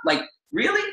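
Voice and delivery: high, rising incredulous question. Retold or imitated. Husband catches himself complaining about a smoothie cup, incredulous. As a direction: question your own pettiness in disbelief. Mocks his own petty complaint.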